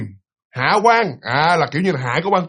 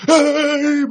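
high startled yell, pitched way up; mock yell; 9 semitones higher, slower than usual. Girl yelling at the funeral after hearing the ghost, high and startled. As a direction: cry out in fright at the voice beside you. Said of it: "bạn la lên như vậy nè".

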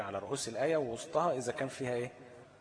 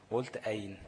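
ordinary talk, nothing marked

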